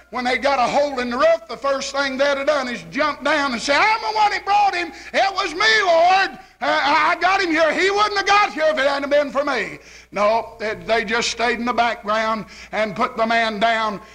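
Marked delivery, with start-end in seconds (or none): 3.7-9.8 high-pitched, eager claim of credit; hammed-up self-important bragging; mock boasting preacher. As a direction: crow like a glory-seeking preacher, easing off at the end. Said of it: "If it had been the average Baptist preacher".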